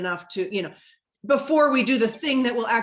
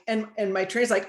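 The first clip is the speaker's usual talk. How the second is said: ordinary talk, nothing marked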